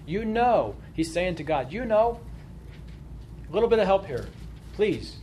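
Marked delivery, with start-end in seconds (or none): 0.1-0.8 mild acted cry to God; pleading cry. Job appeals to God that he is innocent, pleading. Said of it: "what he's crying out to God". 1.7-5.2 higher-pitched mock plea for help; pleading cry. Job asks God for a little help, mildly crying out. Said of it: "what he's crying out to God".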